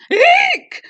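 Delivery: loud high shriek. Acted. Elephant startled by the mouse, high shriek. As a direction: squeal in fright. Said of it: "shouted the elephant".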